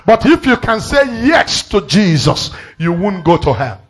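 high, loud, ringing preacher's cry; preaching at full force. Acted. Preacher crying out to the church, high and loud. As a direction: drive it home to the congregation.

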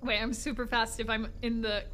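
ordinary talk, nothing marked

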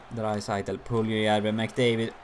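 usual speaking voice

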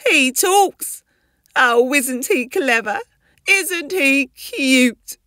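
high, loud, gushing mum voice; delighted mother. Acted. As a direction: coo proudly over the new baby. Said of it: "Dora was delighted".

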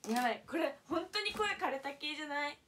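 strained, worn-out voice from yelling at games; hoarse voice. Real, not acted. Streamer notices her yelled-out voice, hoarse and strained. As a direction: she hears her voice cracking and rasping.

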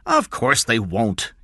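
slow, emphatic sharp dismissal. A narrator's reading. King answers the worried question, sharp. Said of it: "King said sharply".

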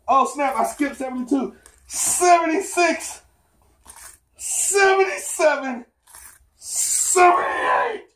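high, slow, strained counting, rising toward a cry; straining through push-ups. Real, not acted. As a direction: force out each count mid push-up. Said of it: "Gotta psych myself out".

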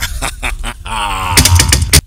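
big, loud, drawn-out cackle; loud laugh. Real, not acted. Vlogger cracking up after his own sign-off, loud laugh. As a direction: burst out laughing, loud and long.

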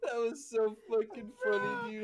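high, laughing speech; real crack-up, words squeezed through laughter; helpless laughter. Real, not acted. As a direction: talk while cracking up. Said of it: "I'm crying".

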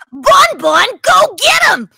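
very high, loud cartoon shout; mascot battle cry. Mascot sending Bon Bon to get him, high excited shout. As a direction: yell it out high and gleeful. Mimics Funtime Freddy catchphrase.

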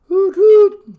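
slow loud hooting owl. A narrator's reading. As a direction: hoot it long and eerie. Owl hoot sound in narration.

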